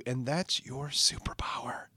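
whisper. Acted. Whispered dramatic line.

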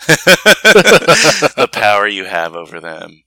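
slow, laughing through the words. Real, not acted. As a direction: let the laugh carry it.

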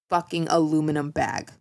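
normal talking voice

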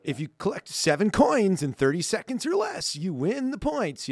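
high, hyped rules announcement; game-show host. Retold or imitated. Imitating Nick Arcade challenge rules, hyped host voice. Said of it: "he really talked like this".

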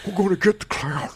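high, strained mocking impression; hammed-up, put-on overacting voice; Bill. Host imitating the actor vowing to get the clown, mock overacting. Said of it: "he's just talking like this".

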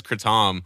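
Kratom as "kruh-TOM"; Hamilton's pronunciation. Retold or imitated. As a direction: say Kratom stressed on the end. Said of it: "he always says Kratom".

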